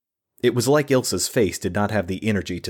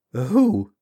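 shaky who; 6 semitones higher, 4 dB louder than usual. Acted. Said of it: "keep it from doing more".

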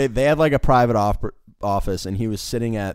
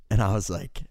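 ordinary delivery

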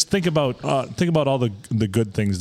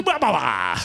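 Adam Sandler scat. Retold or imitated. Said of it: "you could do".

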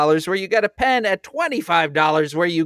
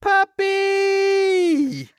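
squealing with delight; high delighted cry of puppy; excited. Real, not acted.

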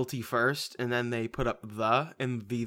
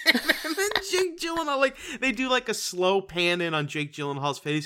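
usual speaking voice

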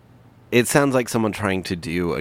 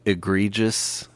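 slow drawn-out; slower than usual. Pretend. Drawn-out funny word offered.

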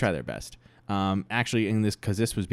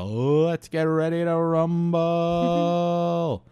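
high, loud, drawn-out ring announcer; boxing announcer; 9 semitones higher, 5 dB louder, much slower than usual. Retold or imitated. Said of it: "he ended it with a".

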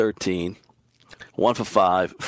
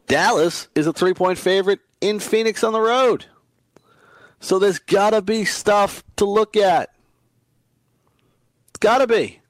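much higher and louder, building hype; excited hype; 10 semitones higher, 4 dB louder than usual. Real, not acted. Host hyping listeners on a Dallas matchup, excitedly. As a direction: sell the pick with rising excitement.

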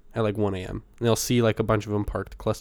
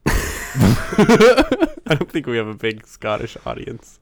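slow, laughing through it; 6 dB louder, much slower than usual. Real, not acted. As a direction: chuckle out the line. Laughing at own joke.

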